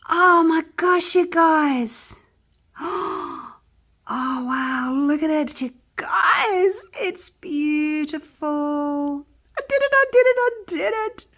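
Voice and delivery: high, loud squeals of joy; excited. Real, not acted. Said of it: "I'm so excited".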